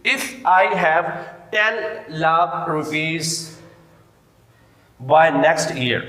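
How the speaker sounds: very slow and loud, word by word; slow dictation. Shown as a demonstration. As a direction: dictate the example loudly for the class. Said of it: "dictating an example sentence".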